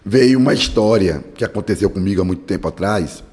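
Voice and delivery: normal talking voice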